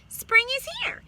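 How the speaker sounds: very high, loud chirp. A narrator's reading. Bird chirping to the cat, very high and loud. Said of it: "the bird chirped".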